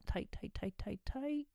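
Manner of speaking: strained high cues. Shown as a demonstration. Coach cueing listeners to hold tight, high and strained. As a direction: squeeze the words as if tensing. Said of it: "We're gonna tighten up".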